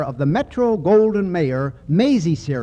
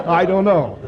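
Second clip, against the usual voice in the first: chuckling. Real, not acted. Host jokes about Susie's schooling.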